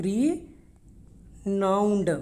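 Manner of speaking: very slow Renowned, long pause after RE; syllable-by-syllable pronunciation. Shown as a demonstration. Teacher showing how it is pronounced, broken in two. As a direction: say RE, wait, then a drawn-out NOWNED in Renowned. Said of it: "ये इसकी pronunciation है".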